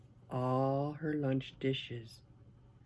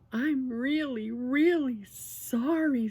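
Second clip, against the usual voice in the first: put-on pleading child apology; 10 semitones higher, 5 dB louder than usual. A narrator's reading. Harriet to her mother after being yelled at, high pleading apology.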